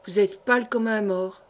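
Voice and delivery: mildly faltering with dread; fearful stammer. A narrator's reading. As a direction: let a touch of contagious fear in. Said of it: "balbutia-t-il, gagné par l'épouvante contagieuse".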